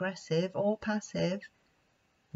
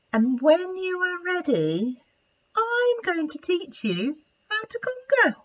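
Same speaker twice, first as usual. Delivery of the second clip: high, eager promise; excited Monty; 12 semitones higher, 4 dB louder than usual. Acted. Monty excitedly promises Esther a conga, very high. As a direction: bubble over with excitement.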